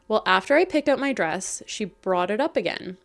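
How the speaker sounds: normal talking voice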